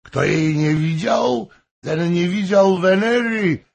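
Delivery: swooning over his goddess wife; rapturous drunk courtier. A narrator's reading. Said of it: "Hoym praising his wife". (rozmarzony, pijany zachwyt, wyżej i głośniej)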